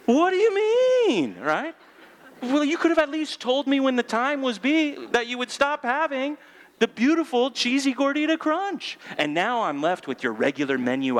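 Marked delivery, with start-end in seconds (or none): 0.1-9.0 high-pitched, louder hammed-up rant at the clerk; mock outrage. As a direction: squeal it out like a betrayed customer. Ranting at Taco Bell over gordita.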